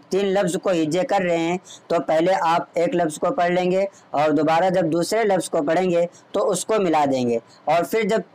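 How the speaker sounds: ordinary delivery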